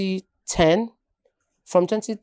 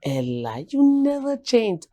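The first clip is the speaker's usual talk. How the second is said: lightly imitated old schoolmate, pitched up on the second half; amazed schoolmates; 6 semitones higher than usual. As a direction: mimic a classmate's high, drawn-out amazement. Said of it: "my secondary school mates will see me today, like".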